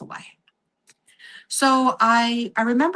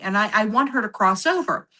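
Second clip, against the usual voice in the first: normal talking voice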